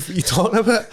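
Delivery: high incredulous sneer; mocking retort. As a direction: scoff at him.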